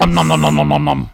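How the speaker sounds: loud munching monster noms; snapping teeth. Acted. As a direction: chomp it like Cookie Monster. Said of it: "Like Cookie Monster".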